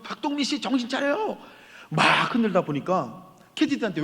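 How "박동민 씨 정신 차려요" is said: high-pitched retold call to wake him; urgent calling. Pastor calling to the collapsed man, urgent and high. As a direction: call his name to bring him round.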